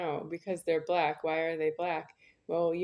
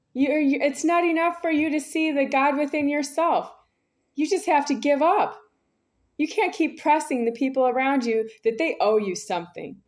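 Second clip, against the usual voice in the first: loud, much higher, tense scolding; strained angry preaching; 9 semitones higher, 7 dB louder than usual. Real, not acted. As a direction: lecture them at the top of your voice. Rant turns preachy, strained.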